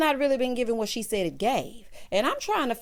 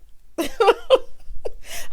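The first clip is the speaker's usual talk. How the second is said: loud laugh; 13 semitones higher, 6 dB louder than usual. Real, not acted. Laughing after the yelling clip.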